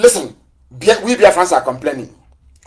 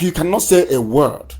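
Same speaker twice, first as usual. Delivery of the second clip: ordinary delivery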